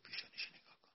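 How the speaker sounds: hushed whisper, barely voiced; whispered aside. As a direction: whisper it like a secret tip. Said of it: "من یواش دست اشاره کرد".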